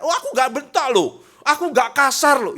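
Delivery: high, loud indignant denial; defensive protest. Acted. Spouse denies being rude, high and loud in protest. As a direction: insist you were never harsh. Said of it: "Ya tapi itu nuncep".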